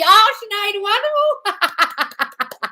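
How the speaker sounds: high, loud, drawn-out wonderful; gushing delight. Real, not acted. Praising a fan's news in slow high sing-song.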